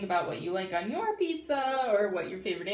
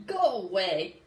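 mock grumpy shooing; 3 semitones lower, 5 dB louder than usual. Froggy shooing sister.

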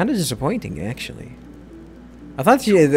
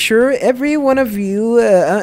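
high bubbly sing-song; cute voice; 3 semitones higher than usual. Acted. Mayabe promising power to her cuties, high and bubbly. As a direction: gush like a giddy fortune girl. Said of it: "She talks, uh, real cute".

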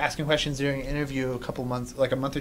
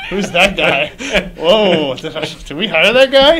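louder, amused mock disbelief; mock bewilderment; 3 semitones higher, 10 dB louder, slower than usual. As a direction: play a baffled onlooker, loud. Mock coworkers reacting to awkward exit.

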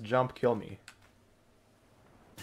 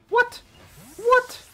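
very high, loud disbelief; shocked outcry; 7 dB louder than usual. Real, not acted. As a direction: squawk it in sheer disbelief. Shocked that opponent ran away.

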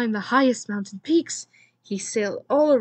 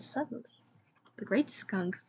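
ominous whisper; 8 dB quieter than usual. A narrator's reading. Ominous whisper narrating famine.

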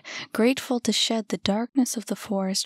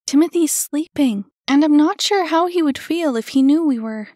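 higher, louder anxious caution; urgent, louder worry; urgent warning; 7 semitones higher, 8 dB louder than usual. A narrator's reading. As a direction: keep them from waking him. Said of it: "she warned".

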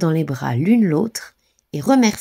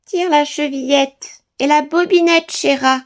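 much higher sweetened voice; wolf faking a gentle grandmother; softened wolf voice; 9 semitones higher than usual. A narrator's reading. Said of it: "en adoucissant sa voix". (le loup adoucit sa voix, aiguë et douce)

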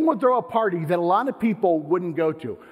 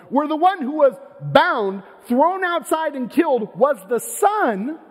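higher, intense preacher building to a peak; fervent preaching; 8 semitones higher, 4 dB louder than usual. Acted. Pastor to congregation, revealing Jesus in the parable, fervent preaching. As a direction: drive the point home from the pulpit.

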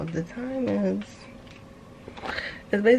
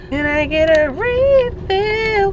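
calling out; 11 semitones higher, 10 dB louder, slower than usual. Real, not acted. Calling out in shop.

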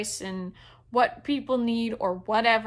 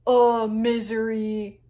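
slow, drawn-out mock lament; mock misery; 4 dB louder, slower than usual. Reader mocking the book's gloom, mock misery. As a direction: wallow in it, hammed-up and slow.

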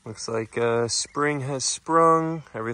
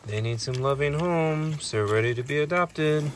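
ordinary delivery

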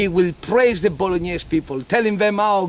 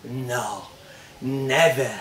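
ordinary talk

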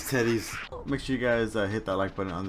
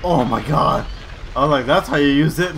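excited shout; 4 semitones higher, 10 dB louder than usual. Real, not acted. Excited in game.

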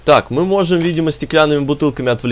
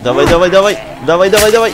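loud, higher, rapid urging; excited urging; 6 semitones higher, 6 dB louder, faster than usual. Real, not acted. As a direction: egg it on in the heat of battle. Urging during fight.

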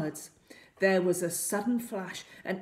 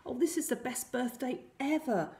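put-on higher-pitched birthday boy; a boy delighted with his birthday present; young Peter; 6 semitones higher than usual. A narrator's reading. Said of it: "Peter said".

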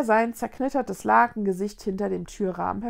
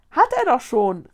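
loud, eager call; calling over; 3 semitones higher, 8 dB louder than usual. A narrator's reading. Linus answers the ghosts, calling out. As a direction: call it across to a crowd. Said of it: "rief Linus zu den Geistern".

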